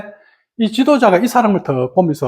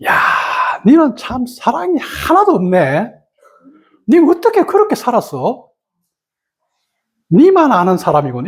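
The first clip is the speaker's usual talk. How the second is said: scolding rebuke; 5 semitones higher than usual. Retold or imitated. Leader scolding the newcomer who asks for teaching, a cutting rebuke. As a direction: tear into him bluntly. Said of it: "쭉 까는 거야".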